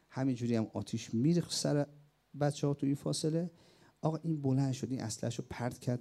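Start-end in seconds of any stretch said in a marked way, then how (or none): none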